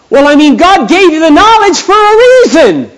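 high, loud preacherly push; urgent exhortation. Retold or imitated. Pastor urging a coworker, loud exhortation. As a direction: press the point home to him. Pastor retelling his own advice.

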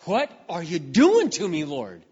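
loud, pleading outcry at God; retold desperate cry. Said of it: "tears are streaming down my face".

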